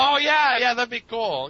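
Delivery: high, loud burst of excitement; suddenly thrilled by the idea; excited. Real, not acted.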